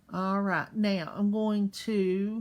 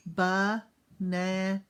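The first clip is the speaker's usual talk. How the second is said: "Banana" stretched slowly, one jaw drop per syllable; slow chin-drop syllables; 2 semitones lower, much slower than usual. Shown as a demonstration. Teacher to kids, slow chin-drop counting. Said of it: "How many times did my chin drop".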